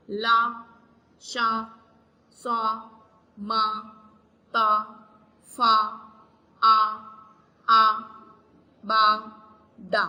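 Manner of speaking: सा, चा, का said alone, each a short loud syllable; syllables without spelling. Shown as a demonstration. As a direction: read only the syllables, one by one. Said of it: "अब इसको हम बगैर ज़बर के पढ़ते हैं". (सिर्फ़ आवाज़ें, एक एक करके)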